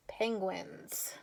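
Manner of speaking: soft whisper of the word. Shown as a demonstration. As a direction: whisper it to the children like a secret. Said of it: "whispers the word after reading".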